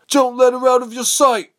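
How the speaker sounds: very high, loud soldier's command; yelling. A narrator's reading. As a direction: yell the order across to your men. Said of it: "They began pointing and yelling".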